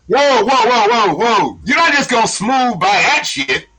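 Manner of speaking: high, loud stunned whoa; loud high-pitched shock at a hot-dog shop selling burgers; amazed disbelief. Real, not acted. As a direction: stop him cold in disbelief. Reacting to surprising news.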